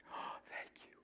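soft breathy whisper of thanks; grateful whisper. Retold or imitated. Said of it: "Peter's wife's like".

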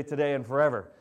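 usual speaking voice

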